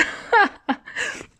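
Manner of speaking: high-pitched incredulous laugh; high, helpless cackle. Real, not acted. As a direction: burst out laughing at the absurdity. Laughs at absurd ending.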